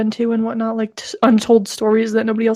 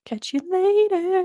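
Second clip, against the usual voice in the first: high playful sign-off; 9 semitones higher, slower than usual. Pretend.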